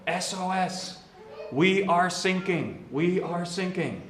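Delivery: high, slow, drawn-out SOS call; radio distress call. Retold or imitated. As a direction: call out for help over a crackling radio, slowly. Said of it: "you hear the crackling, and they go".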